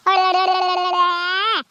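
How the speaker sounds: rolling, wordless lulling trill; soothing tongue trill. Acted. Carer hushing a crying baby, lulling tongue trill.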